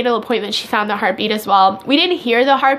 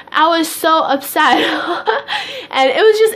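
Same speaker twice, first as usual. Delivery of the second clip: laughing while telling; 5 semitones higher than usual. Real, not acted. To viewers, recalling the spaghetti: laughing. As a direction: laugh through the words at herself.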